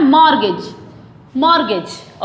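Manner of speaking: "मॉर्गेज" as MOR-gej, silent t; "मॉर्गेज" falling, ending like "edge"; correct pronunciation. Shown as a demonstration. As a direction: model "मॉर्गेज" with short e and j ending. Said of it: "क्या बोला जाता है?".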